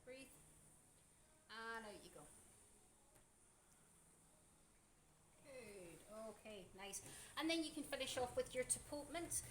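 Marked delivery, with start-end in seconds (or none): 0.0-7.0 soft. Real, not acted. Soothing client on exhale.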